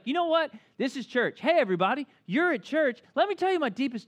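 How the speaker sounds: mock a person announcing secrets to the whole church; oversharing churchgoer. Preacher mocks a churchgoer blurting secrets, hammed-up loud. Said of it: "you can be like".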